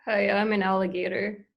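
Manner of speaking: ordinary talk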